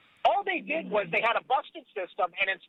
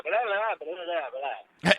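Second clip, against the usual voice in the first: slow, mock droning babble; 5 semitones lower, much slower than usual. Co-host mocking contradictory political talk, dismissive babble. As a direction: dismiss it as drawn-out nonsense.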